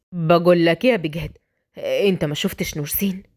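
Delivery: low male voice, probing; worried husband asking; questioning brother. Acted.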